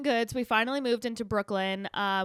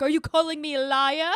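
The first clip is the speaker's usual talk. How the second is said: mock indignant challenge; 6 semitones higher, 8 dB louder than usual. Quoting the defensive manager.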